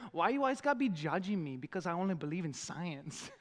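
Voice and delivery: put-on Mexican-accented comic voice; Esqueleto. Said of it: "At one point he says".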